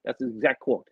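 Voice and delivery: usual speaking voice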